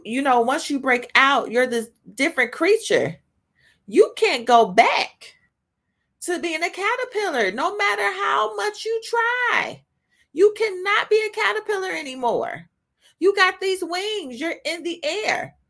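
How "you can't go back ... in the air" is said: high, forceful preacher's delivery; impassioned preaching. Real, not acted. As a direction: preach it with full conviction, pitched high.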